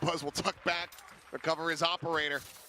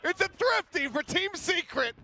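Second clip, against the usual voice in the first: high, slow, awestruck hype; esports caster; 11 semitones higher, 5 dB louder, slower than usual. Real, not acted. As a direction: savour the upset, still buzzing. Stunned caster after clutch.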